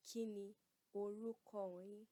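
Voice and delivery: "Kí ni orúkọ yín?" broken apart, "orúkọ" drawn out; slow, respectful asking of a name; slow polite question. Shown as a demonstration. Teacher models asking an elder's name, slowly. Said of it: "Repeat after me".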